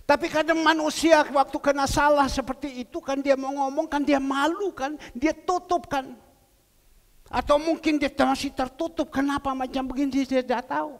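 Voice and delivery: loud, emphatic sermon voice; preaching. Acted. Preacher explaining shame to the church, at full force. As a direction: drive it home to the listeners.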